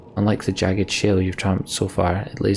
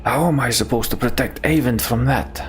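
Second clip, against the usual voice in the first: higher-pitched, incredulous protest; pitched up, pleading disbelief; alarmed protest; 4 semitones higher than usual. Acted. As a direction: blurt it out in alarm at an impossible order. Protest at a dangerous order.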